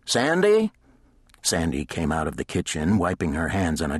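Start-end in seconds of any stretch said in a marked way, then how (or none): 0.1-0.7 high, loud call up the house. A narrator's reading. Woman calling the housekeeper from across the house. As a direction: call her from the stairs. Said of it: "and shouted".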